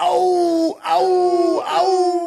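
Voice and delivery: high strained dying yells; death cry. Pretend. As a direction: yell out a fighter's death. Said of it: "Johnny Cage's triple head uppercut".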